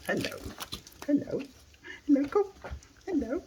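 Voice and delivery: high, sing-song pet greeting. Real, not acted. Streamer greeting his dog Coco, high baby talk. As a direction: coo hello up high to the dog.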